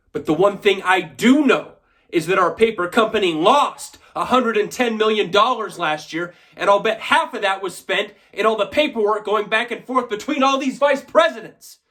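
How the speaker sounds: high, forceful voice climbing to the end; heated accusation. Acted. Shareholder blames vice presidents for company losses, heated. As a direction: drive it out loudly, pitch rising as you go.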